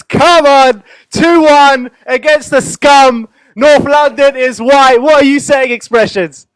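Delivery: loud, high, slowed-down hype shout; big drawn-out celebratory bellow to the crowd; hyped shout. Real, not acted. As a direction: roar it out like a stadium chant. Hype intro for Mister Expressions.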